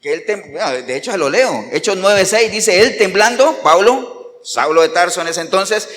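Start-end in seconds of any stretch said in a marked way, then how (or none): none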